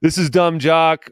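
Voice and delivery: loud, pumped show opener; hyped-up intro. Real, not acted. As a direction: kick off the show with energy.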